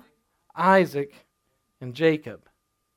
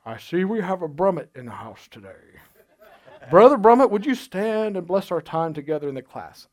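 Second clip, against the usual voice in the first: put-on slurred, mannered old-school professor voice; Doctor Jesse Moon. Professor calling on a student during attendance, in a quirky imitated voice. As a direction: impersonate a professor's quirky, slushy way of talking. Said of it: "this is how he talked".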